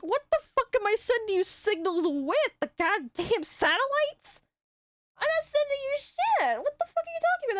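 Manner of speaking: incredulous retort. Retold or imitated. Retold rant at a guy friend.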